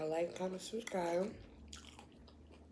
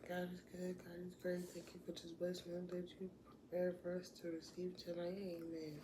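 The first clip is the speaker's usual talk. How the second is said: soft, hushed grace before eating; mealtime prayer; 11 dB quieter than usual. Real, not acted. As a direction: say grace quietly over the food.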